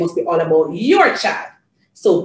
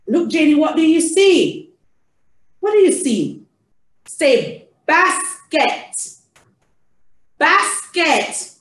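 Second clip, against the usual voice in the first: higher, clearly separated teaching words; child-directed prompting; 6 semitones higher, slower than usual. Shown as a demonstration. Getting Janie to say basket, animated and high. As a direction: coax a toddler to say the word.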